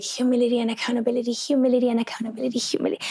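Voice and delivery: fast, repeated under-the-breath chant; quick self-talk mantra in the car before apologizing; muttered mantra. Retold or imitated. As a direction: rattle it off to calm yourself down. Reciting a self-calming mantra.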